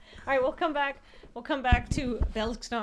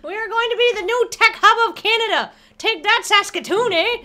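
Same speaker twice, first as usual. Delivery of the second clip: loud, high-pitched mock Canadian; Bob and Doug McKenzie; 9 semitones higher, 7 dB louder than usual. Co-host boasting of Canada's new tech hub, hammed-up Canadian. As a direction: belt out a broad hoser Canadian boast. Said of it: "I need to do a Bob and Doug McKenzie".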